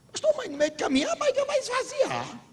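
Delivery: high, innocent reply of a friend; naive friend. Retold or imitated. Friend naively answers the pastor in a high voice. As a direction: play it dumb and high.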